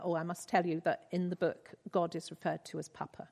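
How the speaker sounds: ordinary delivery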